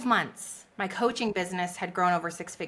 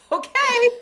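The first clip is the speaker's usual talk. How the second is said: amused okay; 9 dB louder than usual. Real, not acted. Laughing resigned okay.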